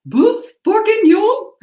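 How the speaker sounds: put-on high, slow, warbling Julia Child voice; Julia Child imitation. Cooking host imitating a famous chef, high and warbling. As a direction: sing out the dish name like Julia Child. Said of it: "That's my Julia Child imitation".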